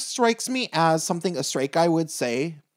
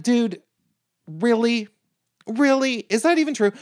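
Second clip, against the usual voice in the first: incredulous; 7 semitones higher than usual. Real, not acted. Incredulous rant about drag mother.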